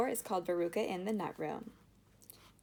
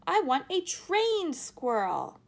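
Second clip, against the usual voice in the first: slow, high, loud demand; demanding shout; 10 semitones higher, 6 dB louder, slower than usual. A narrator's reading. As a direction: spell out the demand. Spoilt girl demanding.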